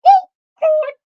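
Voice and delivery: high, loud mortified squeak; little squeal. Retold or imitated. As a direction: imitate a small embarrassed yelp. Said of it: "I let out this little".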